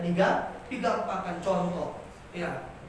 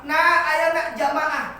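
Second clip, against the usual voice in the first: calling out; 5 semitones higher, 6 dB louder than usual. Shown as a demonstration. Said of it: "biasanya kayak gitu".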